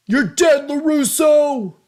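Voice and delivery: high, loud movie-bully yell; bully's shout. Retold or imitated. Quoting Karate Kid, the bully's loud threat. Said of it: "You know, Karate Kid".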